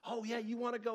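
high, loud mock challenge; a retold heckler daring him to go; mock tough guy. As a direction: ham up a bar brawler itching to fight. Bar heckler picking a fight, voiced.

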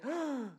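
a sharp shocked gasp up high; mock parent gasp. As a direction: gasp like a parent finding the mess. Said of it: "they say".

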